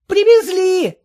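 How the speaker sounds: high, far-carrying call; shouting. A narrator's reading. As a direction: shout it across the yard. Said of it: "крикнул".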